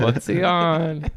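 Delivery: high, slow, teasing question; high drawn-out mock question. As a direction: drag it out, mocking him. Teasing Terry's stoned look.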